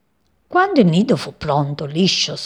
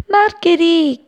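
high, loud calling out; searching call; 12 semitones higher, 5 dB louder, slower than usual. A narrator's reading. Sparrow searches the field for his friend, calling out. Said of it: "chiamò cercandola in lungo e in largo".

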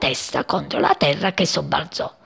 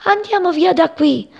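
high-pitched panicked calling; scared birds crying out; frightened cries; 12 semitones higher than usual. A narrator's reading. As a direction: urge everyone to flee, pitched high. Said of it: "tremavano di paura e gridavano".

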